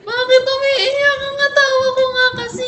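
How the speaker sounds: high, sustained singsong wail; mock wail. Streamer asking viewers why their laugh is crying, mock wailing. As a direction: wail it high, stretching the last vowels.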